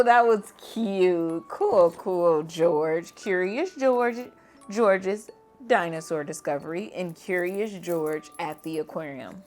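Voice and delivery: plain reading voice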